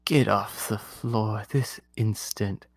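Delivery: slow, soft, icy order; a queen who expects obedience; cold royal command. Acted. Queen ordering the card off the floor, slow and icy.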